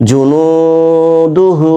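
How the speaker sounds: জুনুদুহু with long held নু and হু; one-alif elongations. Shown as a demonstration. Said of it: "নু ওখানে এক আলিফ টান দিলাম". (জুনুদুহু-তে নু ও হু টেনে পড়া)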